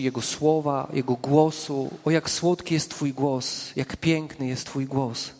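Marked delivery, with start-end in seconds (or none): 2.0-5.3 soft, slow, adoring murmur; tender praise. Acted. Preacher marvelling to the congregation at God's sweet voice, tender and hushed. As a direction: savour each word like a caress.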